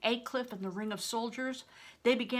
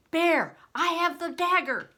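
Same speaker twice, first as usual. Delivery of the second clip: loud, high triumphant call; urgent loud cry; shout; 9 semitones higher, 8 dB louder than usual. A narrator's reading. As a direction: shout it out so he hears. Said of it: "I shouted".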